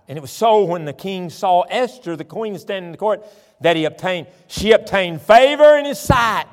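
declaimed verse rising to a high, loud finish; forceful scripture reading. Real, not acted. Preacher reading of the queen winning favor, building preaching force. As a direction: build to a ringing proclamation.

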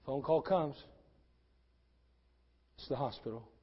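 preacher turns quiet and grave; hushed preaching. Acted. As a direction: drop it down low and quiet. Hushed sermon passage.

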